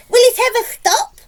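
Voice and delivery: high-pitched, loud character shout; excited shout. A narrator's reading. Aunt sees the peach swelling, excited high shout. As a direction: shout the question, pitched right up. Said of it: "Aunt Sponge shouted".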